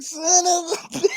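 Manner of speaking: high squeal broken by laughter; mimicked little boy cursing. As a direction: copy the kid's curse while laughing. Listener copies the boy's cursing.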